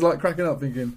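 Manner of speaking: usual speaking voice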